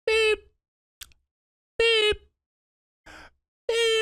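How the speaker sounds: high electronic beeps, one at a time; imitating card reader beeps. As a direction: mimic a machine beeping slowly. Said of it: "And he's just beep".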